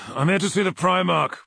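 high, loud formal announcement; announced. A narrator's reading. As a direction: declare it loudly, pitched well up. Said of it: "he announced".

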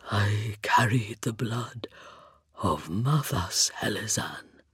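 low, slow, sighing whisper; sighing hollow voice. A narrator's reading. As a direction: breathe it out like the wind. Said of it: "the hollow timbre of his voice rose like the sighing of the wind".

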